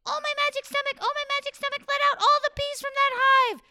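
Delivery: high, loud chanting call; high rooster incantation. Acted. Rooster summoning the bees from his stomach, high call. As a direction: call out the spell in a squeaky bird voice.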